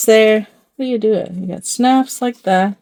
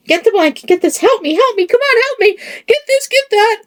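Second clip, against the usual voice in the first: frantic yell; 13 semitones higher, faster than usual. Retold or imitated. Retells yelling at husband.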